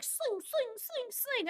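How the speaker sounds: high, squeaky chant while miming hook swings; sing-song each sling like a cartoon slasher; mock killer swinging. Killer swinging her hook at the girls, high squeaky chant.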